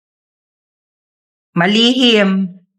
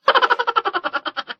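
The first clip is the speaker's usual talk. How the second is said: laugh; 11 semitones higher, 6 dB quieter than usual. Acted. Mother laughing.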